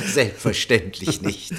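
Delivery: chuckling through the words, then a high burst of laughter; conspiratorial laugh. Acted. Minister laughs with the director over the rigged scandal. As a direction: laugh along, sharing the dirty secret.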